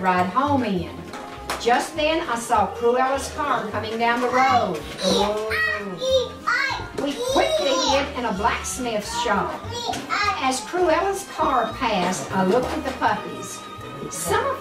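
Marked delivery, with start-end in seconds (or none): none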